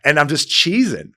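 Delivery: ordinary delivery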